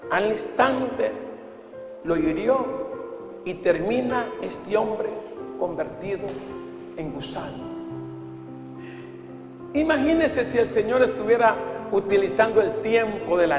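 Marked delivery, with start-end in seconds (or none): none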